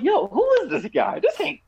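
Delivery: high, disbelieving mock cry; incredulous. Mocking the new Bond, high incredulous voice. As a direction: squeak out your disbelief at the screen. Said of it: "I'm like".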